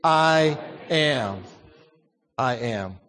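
I am said slowly and weightily; congregation read, emphatic. Shown as a demonstration. Preacher leading the church in reading I am. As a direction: lean into I am. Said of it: "let's read those together".

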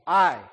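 "I" punched loud and drawn out; stress on I. Shown as a demonstration. Teacher showing a class how emphasis changes meaning, stress on I. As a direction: lean hard on "I", standing alone. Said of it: "Put the emphasis on me".

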